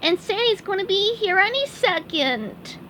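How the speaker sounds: high worried cartoon voice; squeaky worried sponge; SpongeBob voice.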